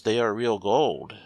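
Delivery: ordinary delivery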